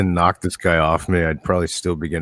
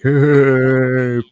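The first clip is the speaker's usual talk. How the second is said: higher, louder drawn-out mock holler; jeering football player; 8 semitones higher, 6 dB louder than usual. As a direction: imitate a jock's long taunting "hey". Imitating football player pouring beer.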